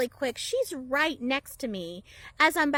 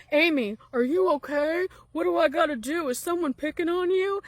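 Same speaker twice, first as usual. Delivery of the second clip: louder, higher protective tough-guy voice; burly neighbour rushing to defend Amy, loud and protective; macho neighbour; 4 semitones higher, 4 dB louder than usual. Retold or imitated. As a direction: puff up like a hero rushing in. Neighbour bursts out to defend her.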